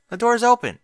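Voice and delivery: high, loud call over running water. Acted. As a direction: raise the voice over the shower. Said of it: "speaking slightly louder over the sound".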